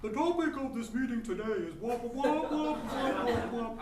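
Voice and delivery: boring boss impression. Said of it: "do your impression of him".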